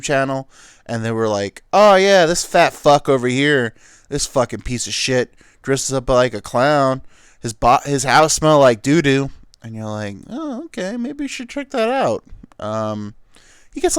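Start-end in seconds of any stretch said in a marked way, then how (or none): 1.7-9.3 loud YouTuber trash-talking; loud, hammed-up call-out; mock outraged rant. Mock YouTuber ranting about Gacy. 10.3-12.2 much higher put-on voice; high-voiced, mock-innocent reply; mock curious viewer. Imagined viewer reacting to rant.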